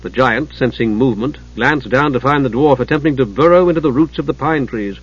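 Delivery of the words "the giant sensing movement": ordinary delivery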